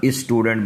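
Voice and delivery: स्टूडेंट said "is-tudent"; wrong leading vowel. Shown as a demonstration. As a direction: put an "i" before the s of स्टूडेंट. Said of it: "सब बेड़ा गर्क हो गया".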